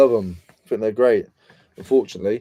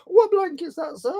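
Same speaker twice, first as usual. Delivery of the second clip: much higher squeaky seller voice; high put-on voice of a car boot seller; mock seller. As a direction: play the meek stallholder. Role-plays car boot seller.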